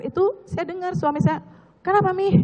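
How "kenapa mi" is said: higher-pitched quoted call of a husband; mildly raised, imitating a questioning shout; retold shout. (menirukan teriakan suami)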